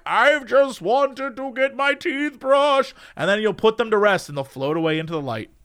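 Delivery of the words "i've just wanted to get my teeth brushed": slow, high ghostly voice. Acted. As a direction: float it out like a grateful ghost. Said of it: "the ghost will thank you and be like".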